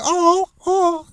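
very high, hammed-up moaning woman; high-pitched parody of bedroom noises; mock sex voice. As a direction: squeal it in a fake falsetto. Acting out a woman during sex.

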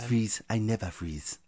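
put-on slow, deliberate African accent; African businessman. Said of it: "like an African businessman".

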